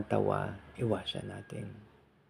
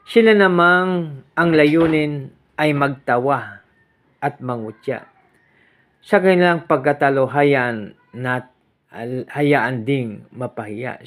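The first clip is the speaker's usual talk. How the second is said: louder, slow proclamation of the verse; solemn scripture reading; 4 semitones higher, 9 dB louder than usual. Acted. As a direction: read it out like a preacher at the lectern. Reads psalm verse aloud. (mabagal at malakas na pagbasa ng salmo)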